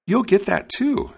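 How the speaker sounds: high, drawn-out bird voice; high-pitched, reassuring little bird; helpful sparrow. A narrator's reading. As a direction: promise it slowly, high and bright as a bird. Sparrow promising the dog bread.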